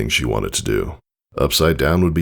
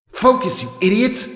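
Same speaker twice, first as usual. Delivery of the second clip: much higher, angry snap at herself; self-scolding. Acted. Filly cursing herself after a miss, angry high snap. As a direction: scold yourself in frustration. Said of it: "She knocked herself on the head".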